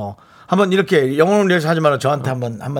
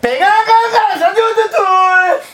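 very high, loud, garbled fast-food call; hammed-up squealing counter lingo; order-calling jargon; 5 dB louder, much slower than usual. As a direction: shout an unintelligible kitchen order, stretched out. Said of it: "그 용어가 난 늘 궁금하더라고".

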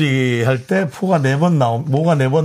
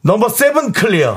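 crew call-out; 5 semitones higher than usual. Shown as a demonstration. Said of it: "다시 한 번 해주세요".

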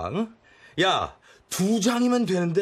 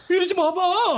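alarmed plea; 10 semitones higher than usual. Acted. Alarmed at finding wounds.

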